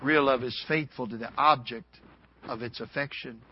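normal talking voice

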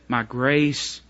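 a hushed, tender God answering Paul; God speaking gently. Acted. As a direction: comfort Paul quietly as God. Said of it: "the Lord looked at him and said".

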